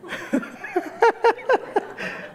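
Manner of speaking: high breathy laugh. Real, not acted. Laughing at the story.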